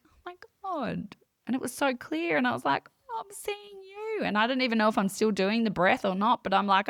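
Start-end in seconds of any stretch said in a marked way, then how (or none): 0.1-1.2 high, breathy-soft wonder, much higher and quieter; a stunned hush of recognition; awed gasp. Retold or imitated. Retelling her vision to the host, awed and high. 3.1-4.2 very high, soft, wondering; amazed recognition. Retold or imitated. Telling the host about meeting Amy, hushed and high.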